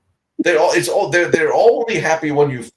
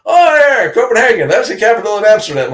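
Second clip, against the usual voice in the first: high, louder ditzy-tourist voice; mocking tourist; 7 semitones higher, 4 dB louder than usual. Mimicking people who mix up Denmark and Holland, ditzy and high. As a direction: play the clueless visitor gushing.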